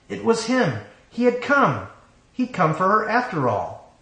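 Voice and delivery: amazed elation. A narrator's reading. Bride seeing her true love arrive, amazed and thrilled. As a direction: let the wonder lift your voice high.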